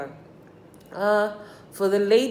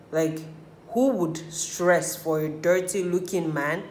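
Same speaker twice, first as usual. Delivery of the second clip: usual speaking voice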